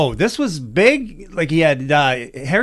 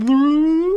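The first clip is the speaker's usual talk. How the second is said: bloop; 9 semitones higher than usual. Retold or imitated. Imitating toilet water rising.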